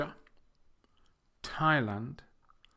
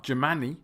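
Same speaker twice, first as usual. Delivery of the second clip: Germany as juh-MAN-ee; MAN stressed in Germany; wrong middle stress; 4 dB louder, faster than usual. Shown as a demonstration. Teacher showing learners a mistake, Germany said juh-MAN-ee. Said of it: "It's not".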